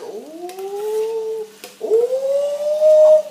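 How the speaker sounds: high squealing ooh, rising to a loud peak; excited ooh. Retold or imitated. Cook tells viewers how the dish looked to her, delighted high squeal. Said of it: "honey, I said".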